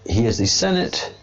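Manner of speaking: ordinary talk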